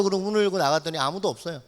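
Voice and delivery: usual speaking voice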